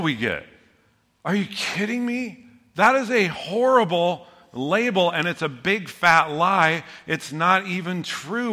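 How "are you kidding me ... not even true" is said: indignant. Real, not acted. Rejects the label with outrage.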